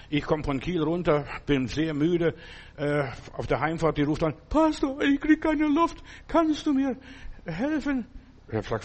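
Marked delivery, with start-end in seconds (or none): 4.5-8.1 high-pitched imitation of a desperate caller; mock pleading woman. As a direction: put on a high, drawn-out plea for help. Acted phone plea from sick woman. (hoch nachgeahmte, flehende Frauenstimme)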